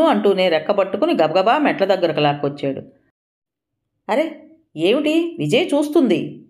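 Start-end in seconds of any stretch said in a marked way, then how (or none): 4.1-6.4 wife struggling against husband's grip, alarmed; alarmed protest. A narrator's reading. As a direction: pull away while pleading. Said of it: "బలంగా పెనుగులాడుతూ అంది".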